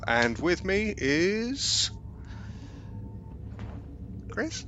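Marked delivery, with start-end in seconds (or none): 4.3-4.6 questioning. Real, not acted. Pause inviting name.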